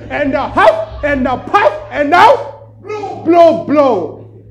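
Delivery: big storybook fox bellow; huffing fox shout. Acted. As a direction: blow the house down, climbing each line. Fox blows house down.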